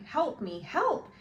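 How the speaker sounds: breaking high into a cry for help; beg, voice shooting up; desperate plea. Acted. Boy begs for help.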